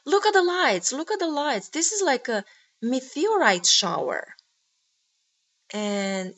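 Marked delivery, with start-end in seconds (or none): 0.0-4.3 high, raised-voice cry of wonder; retold excited yell. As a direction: call out higher and louder in amazement. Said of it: "I just yelled".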